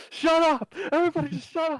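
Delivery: high, loud angry shout; loud sharp voice. Acted. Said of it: "Fargon's just like".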